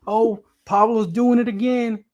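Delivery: high, excited mock fan voice; mocking hyped fans. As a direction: squeal it like a hyped crypto follower. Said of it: "Everybody's saying".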